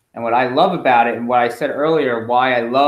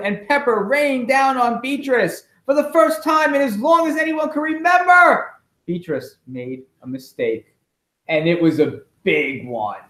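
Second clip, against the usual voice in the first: higher, animated read-aloud; excited storybook reading; 12 semitones higher than usual. A narrator's reading. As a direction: build the chaos for the kids. Reading picture book to kids.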